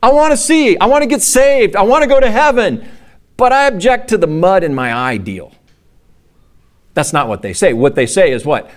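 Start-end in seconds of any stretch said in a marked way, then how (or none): 0.0-5.5 high, mocking whine of a picky seeker. Pastor mocking people who want salvation their way, high whiny voice. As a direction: parody someone who wants heaven on their terms. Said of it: "people say".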